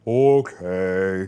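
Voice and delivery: low, drawn-out creature reply; Bigfoot voice. Retold or imitated. Bigfoot answers the family's goodbye, low creature voice. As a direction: put on a lumbering Bigfoot.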